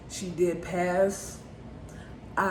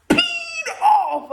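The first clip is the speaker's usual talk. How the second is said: a high, loud yelled burst of anger; retold anger; 7 dB louder than usual. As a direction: shout it out as if still furious. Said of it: "I'm like, I was mad".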